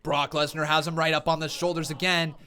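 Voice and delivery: normal talking voice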